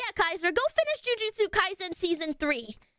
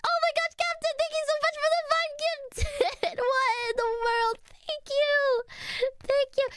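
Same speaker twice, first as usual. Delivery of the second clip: high, squealing gratitude; overwhelmed thanks; 12 semitones higher than usual. Real, not acted. Streamer thanking Captain for five gifted subs, high overwhelmed squeal. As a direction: squeal your thanks in happy disbelief.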